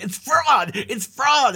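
high, loud hammed-up cries; mock frantic. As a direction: imitate someone coming completely unglued. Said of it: "frantic and just completely unglued".

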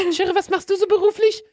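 put-on girly voice. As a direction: play a sweet-talking girl at a bar.

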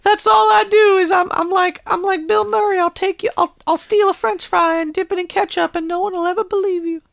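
squeaky boastful cat voice; high cartoon cat voice.

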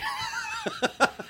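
a sudden squealing laugh; high burst of laughter. Real, not acted. Big laugh at the joke.

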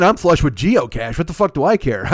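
ordinary talk, nothing marked

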